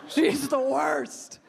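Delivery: very slow, stretched words; slow, drawn-out. Shown as a demonstration. Comic repeating her verdict on Fiorina, very slow. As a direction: drag it out in disgust.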